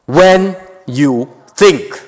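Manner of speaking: each word laid out slowly; slow, deliberate paraphrase; slow retranslation. Shown as a demonstration. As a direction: spell out the plain meaning slowly. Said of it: "I would retranslate, paraphrase it as".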